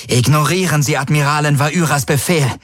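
high, urgent command, a touch breathless. A narrator's reading. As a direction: give an urgent order, slightly out of breath. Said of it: "Ylanas Stimme atemlos über die Comms".

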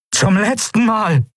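high, drawn-out last warning; final warning. A narrator's reading. Kai warns Sylravan before using the arm brace, threatening. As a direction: pitch it up and stretch each word.

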